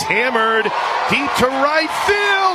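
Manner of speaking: rising broadcaster call; rising excitement. Real, not acted. Home run call building.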